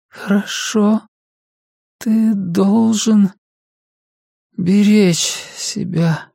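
high, very slow, halting voice; an exhausted patient eking out words; frail, labored mother. Acted. Drowsy mother cautions her son, frail and labored.

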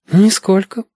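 put-on high-pitched girl's denial; unafraid girl. A narrator's reading. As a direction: deny it lightly in a high girl's voice. Girl character denies being afraid. (бесстрашная девочка, высокий голос)

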